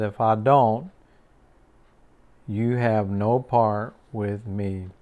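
quiet authority, let it settle; calm solemn Jesus. Acted. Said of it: "And Jesus just looked at him and said".

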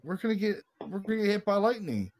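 mock panic. Retold panic in lightning storm.

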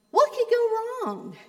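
high, bright carefree question; tossed off high and easy; friend's carefree version. Retold or imitated. Friend asking lightly, high pitched. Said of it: "it sounds totally different from when my wife says".

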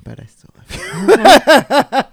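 slow, loud, high mock grandma push on love you; hitting it home. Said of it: "She really wanted to hit it home".

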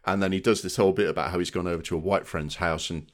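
normal talking voice

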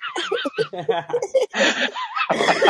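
high shrieking burst of laughter; big laugh. Real, not acted. Group cracks up at joke.

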